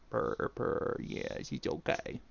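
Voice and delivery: unvoiced soft purring; whispered purr. Real, not acted. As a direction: whisper the purrs to the cat. Soft talk to cat.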